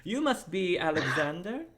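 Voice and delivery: high, courtly retake of the greeting; Kane's greeting. Shown as a demonstration. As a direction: say it again, grander. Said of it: "Actually, I have a quill".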